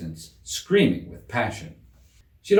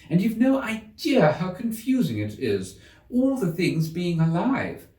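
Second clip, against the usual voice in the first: put-on higher girl's voice, grumbling on and on; complaining Alice; 8 semitones higher than usual. A narrator's reading. Girl complains to the cat about unfair croquet, higher and whiny. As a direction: pour out every grievance like a put-upon girl. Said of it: "Alice began in rather a complaining tone".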